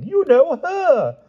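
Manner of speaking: very high, slow, knowing hint; conspiratorial hint. A narrator's reading. Pellinore hinting at the queen, slow and high. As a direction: drag it out with heavy meaning.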